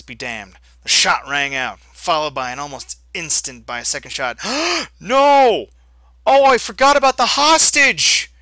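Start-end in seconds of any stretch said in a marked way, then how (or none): 4.4-4.9 sharp high gasp; shocked gasp. Real, not acted. Shock at hostage shot. 5.0-8.3 loud, high dismayed cry; shocked shout. Real, not acted. Player realizes mistake.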